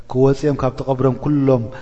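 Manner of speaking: normal talking voice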